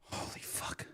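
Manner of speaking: breathy whispered curse. Real, not acted. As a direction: whisper it in disbelief. Aside in disbelief.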